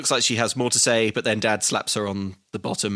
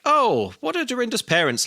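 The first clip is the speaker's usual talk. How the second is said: high mum voice; 11 semitones higher than usual. Acted. Mother voiced in podcast recap.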